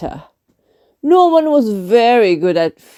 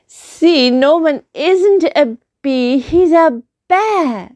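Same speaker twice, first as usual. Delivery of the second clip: Amelia triumphant; 8 semitones higher than usual. Acted. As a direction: point it out triumphantly, pitched up. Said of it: "said Amelia".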